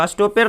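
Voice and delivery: अस्टोपेर as "as-toh-PAIR"; spelling reading अस्टोपेर, ending "-per" with "ay"; mispronunciation demo. Shown as a demonstration. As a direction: say अस्टोपेर wrongly with a clear "e". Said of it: "नहीं होगा".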